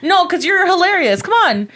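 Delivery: high, fast sugary sarcasm; mock the heckler with fake praise; sarcastic sweetness. Comic to heckler on stage, high sugary sarcasm. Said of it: "I'm like".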